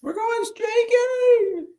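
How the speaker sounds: high, slow, drawn-out yell; movie quote. Retold or imitated. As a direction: stretch out streaking high and slow.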